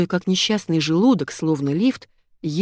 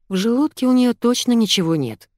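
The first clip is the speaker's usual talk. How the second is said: usual speaking voice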